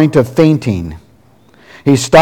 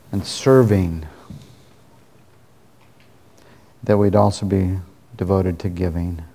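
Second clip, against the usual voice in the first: closing prayer; 2 semitones lower, 3 dB quieter than usual. Acted. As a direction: pray quietly and sincerely to God.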